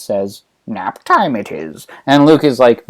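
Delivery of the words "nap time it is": a hint of sly old master; low, soft and slow, dodging the question; Yoda. Old master evading his pupil, low slow Yoda voice. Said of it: "sly old man that he is".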